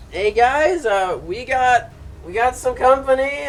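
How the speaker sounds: loud urgent call for help. Acted. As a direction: shout it to your friends across the fight. NPC calls out for help.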